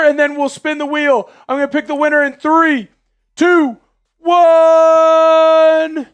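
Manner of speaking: high loud hype announcing; hyped giveaway announcement. Real, not acted. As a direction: hype up the raffle winner.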